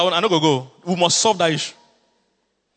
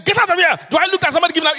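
high, loud imitated yell of a rude clerk; retold shout; 13 semitones higher, 6 dB louder, faster than usual. Pastor imitating the clerk shouting him off, high and loud. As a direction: bark him away like the clerk did. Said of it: "He, he shouted at me".

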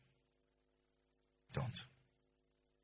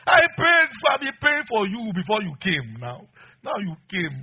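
put-on high, loud shrieking child; screaming baby daughter; mock scream; 8 semitones higher, 10 dB louder, slower than usual. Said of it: "she will scream".

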